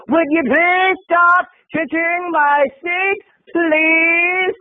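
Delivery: high, slow, over-enunciated demand; exasperated mock politeness. Fur-coat woman scolding whoever kicks her seat, exasperated. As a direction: imitate a huffy stranger stretching each word. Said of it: "and she says".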